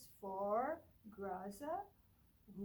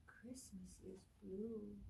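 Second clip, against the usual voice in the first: soft gasp, then a slow hushed whisper; 5 dB quieter, slower than usual. Acted. Teacher revealing a new page to children, gasp and whisper. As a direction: gasp in wonder and whisper the line.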